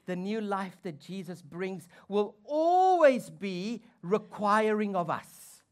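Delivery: slow, loud preacher's build-up; forceful preaching. Acted. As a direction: preach it out slowly and loudly.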